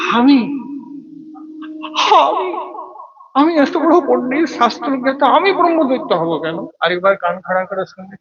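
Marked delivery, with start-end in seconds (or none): 0.1-6.7 high, incredulous cry of "আমি?"; shocked disbelief. Acted. Proud scholar shocked to learn he will become a ghost. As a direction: recoil in horror at hearing his own name.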